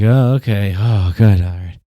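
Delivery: loud, relieved mock sigh of comfort; hammed-up relieved voter; mock relief. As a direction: play a sheep relieved the bad man is gone.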